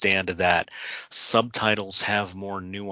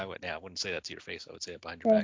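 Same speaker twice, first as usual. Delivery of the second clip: teasing aside; 4 semitones lower, 8 dB quieter, much faster than usual. Real, not acted. Joking aside to co-host.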